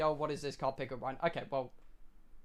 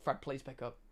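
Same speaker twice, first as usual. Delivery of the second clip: quiet plea; 7 semitones lower, 13 dB quieter than usual. Real, not acted. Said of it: "pleading for Fred to answer".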